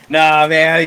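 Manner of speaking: loud, dismissive chuckle into the words; laughing it off. Acted. Axe man reassures visitor after the scare, jovial laughing. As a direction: chuckle and wave the worry away.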